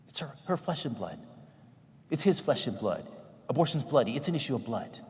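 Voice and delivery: ordinary talk, nothing marked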